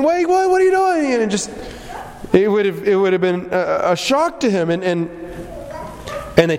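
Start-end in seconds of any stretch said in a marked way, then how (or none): 0.0-1.1 high startled cry of disbelief; shocked protest. Retold or imitated. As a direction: sound caught off guard. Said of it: "and him saying".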